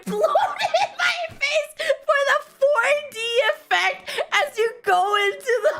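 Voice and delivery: words breaking into high laughter; can barely get it out for laughing; laughing while talking. Real, not acted. Telling the viewers about the water, laughing helplessly.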